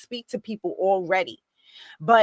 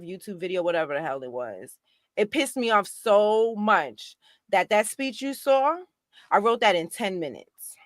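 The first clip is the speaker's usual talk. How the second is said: usual speaking voice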